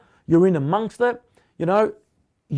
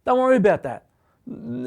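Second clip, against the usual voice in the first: ordinary talk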